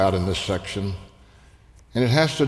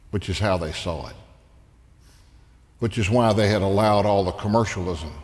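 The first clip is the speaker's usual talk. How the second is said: usual speaking voice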